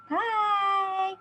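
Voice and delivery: usual speaking voice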